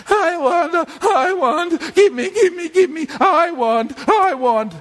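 put-on high, whiny child's nagging; whinging child. Preacher acting a child nagging a parent, whinging. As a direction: pester insistently like a whinging child. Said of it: "a child who whinges continuously".

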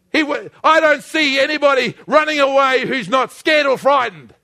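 rally the congregation against the devil; full-force preaching. Real, not acted.

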